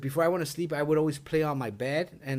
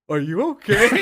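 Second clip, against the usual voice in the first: laughing; 5 semitones higher, 7 dB louder than usual. Real, not acted.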